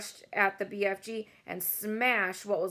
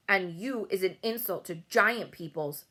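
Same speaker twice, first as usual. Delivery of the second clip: plain reading voice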